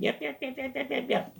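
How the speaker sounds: high-pitched little dog yaps; yapping dog. Retold or imitated. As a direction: imitate a small dog barking in a rapid high string. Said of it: "The dog is barking the whole time".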